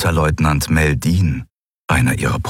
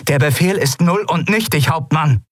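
higher, firm protest called across the room; Kalin calling out; 10 semitones higher than usual. Acted. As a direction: reject the order outright. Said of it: "rief Kalin".

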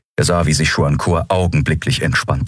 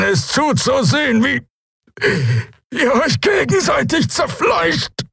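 slow and pained, climbing much higher into a scream; tortured, building to a shout; 13 semitones higher, slower than usual. Acted. Dying prisoner taunts his captors, pained rasp rising to a scream. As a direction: rise from pained rasp to a final hateful cry. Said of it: "von jeder Silbe gequält".

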